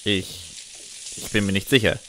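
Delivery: a faltering, half-whispered start, then unsure; mild nervous stammer. Acted. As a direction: stumble over the first words, unsure of yourself. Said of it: "stammle ich".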